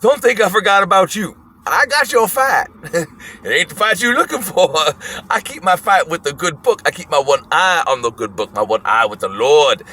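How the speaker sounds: put-on high, feisty old-man voice; high imitated challenge, settling as it ends; old man's challenge. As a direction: pick a fight in a mock old man's voice. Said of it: "dead in the eye with one eye and goes".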